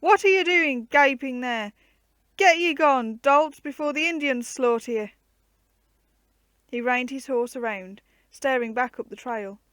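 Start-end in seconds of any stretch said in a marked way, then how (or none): 0.0-5.1 loud, high bellow at the men; roaring. A narrator's reading. Sir Robert yelling at the gaping men, roaring. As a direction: roar them off the path. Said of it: "he pulled up and roared".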